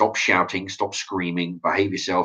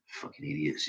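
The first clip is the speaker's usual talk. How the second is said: dropped low and soft, under the breath; muttered insult; 6 semitones lower, 14 dB quieter than usual. Real, not acted. Vlogger muttering about parents who say please to dogs, under the breath. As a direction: grumble it to yourself.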